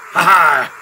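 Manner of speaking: loud triumphant mock laugh; gloating "ha ha". Gamer fooling the game, gloating laugh. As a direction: crow a loud two-beat laugh. Gloats after faking doom.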